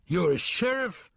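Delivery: high, stunned question. A narrator's reading. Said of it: "looking stunned".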